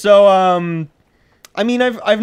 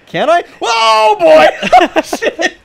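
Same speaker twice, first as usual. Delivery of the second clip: real startled yell, louder and higher; excited shout; 13 semitones higher, 8 dB louder, slower than usual. Real, not acted. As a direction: burst out in sudden surprise. Surprised by a game event.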